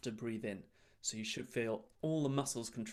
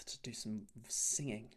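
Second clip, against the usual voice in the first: whispering; 2 semitones lower, 6 dB quieter than usual. Real, not acted. Handing over in whisper.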